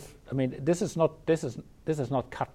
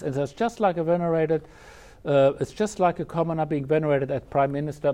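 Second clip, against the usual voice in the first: normal talking voice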